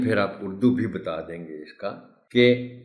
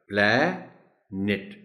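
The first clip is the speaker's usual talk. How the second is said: "Plant" slowly split, plaa... net; syllable by syllable; much slower than usual. Shown as a demonstration. Teacher breaking the word down, "plant" as plaa, pause, net. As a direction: stretch "plant" into two separate syllables. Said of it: "وہ نیٹ بولا جائے گا".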